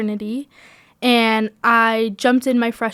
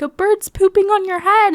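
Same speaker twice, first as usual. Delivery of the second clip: high, louder mock scream; retold excited scream; 10 semitones higher, 5 dB louder than usual. Student voicing friends screaming at her on the beach, mock alarm. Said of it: "then they start screaming".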